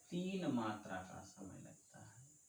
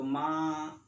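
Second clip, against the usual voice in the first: louder call of माँ, stretched long; 2 semitones higher, 7 dB louder, much slower than usual. Shown as a demonstration. Teacher demonstrates calling for mother, a long loud call. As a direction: call माँ as if across the yard. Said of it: "हम तेज से चिल्लाते हैं".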